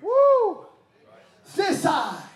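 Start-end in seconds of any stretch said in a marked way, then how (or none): 0.0-0.7 a sudden high excited whoop; preacher's whoop. Real, not acted. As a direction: let out a joyful whoop. Preacher whoops mid-sermon.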